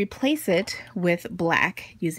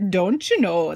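playful, hammed-up Minnesotan accent; 4 dB louder than usual. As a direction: lean into a folksy Minnesotan accent for fun. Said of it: "that was my good Minnesotan accent".

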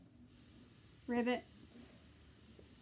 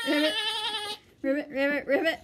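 childish mock frog ribbits; frog croaking; 3 semitones higher, much faster than usual. Magic pony turned frog, croaking playful ribbits. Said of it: "she makes a different animal sound".

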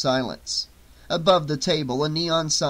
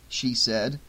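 plain reading voice

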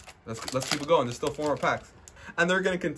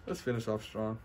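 softer, half to yourself; quiet muttered try; 4 semitones lower, 12 dB quieter than usual. Real, not acted. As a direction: mumble it before saying it properly. Repeats the line louder after.

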